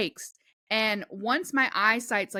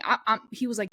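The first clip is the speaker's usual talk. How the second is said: normal talking voice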